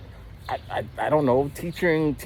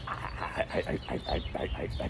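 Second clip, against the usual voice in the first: stammering quietly; 10 semitones lower, 11 dB quieter than usual. Retold or imitated. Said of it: "I'll be like".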